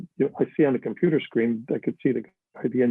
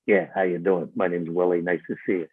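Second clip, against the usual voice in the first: put-on low rough old man greeting; low greeting like the real Fat Tony; imitating Uncle Willie; 6 semitones lower than usual. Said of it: "he looks at everybody and goes".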